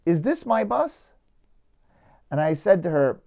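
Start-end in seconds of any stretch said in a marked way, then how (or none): 0.0-1.1 light, higher-pitched imitation of a British woman; slow question with a hint of British accent. Stranded woman asking a tour leader, light British accent. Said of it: "says in a British accent".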